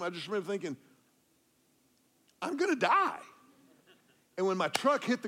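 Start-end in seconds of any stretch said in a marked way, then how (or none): 2.4-3.2 high, louder cry of sudden fear; panicked dream thought. Retold or imitated. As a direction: yelp it as the truck drops. Truck falling in dream.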